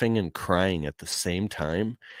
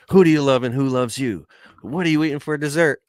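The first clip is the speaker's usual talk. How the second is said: high, eager interviewer voice, quick on the second question; excited interviewer; 8 semitones higher, 7 dB louder, faster than usual. Retold or imitated. Interviewing family members at Thanksgiving, high and eager. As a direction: pitch it up like a cheerful interviewer with a mic.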